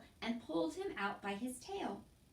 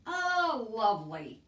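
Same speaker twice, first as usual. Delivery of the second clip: a hint of gruff Cook; 8 dB louder, slower than usual. A narrator's reading. Cook's sarcastic greeting.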